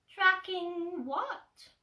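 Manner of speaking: high, slow, curious Piglet; drawn-out squeaky question; tiny high piglet voice, slow. Acted.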